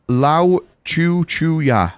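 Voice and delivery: loud, drawn-out syllables; deliberate password. Real, not acted. Player giving the password to the game, loud and drawn out. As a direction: give each syllable slowly and forcefully.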